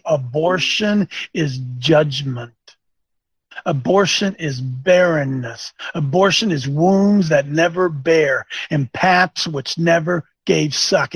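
grave, deliberate pulpit pronouncement; solemn sermon pronouncement, slowed; slow emphatic preaching. Acted. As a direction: hammer each phrase slowly.

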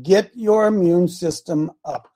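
normal talking voice

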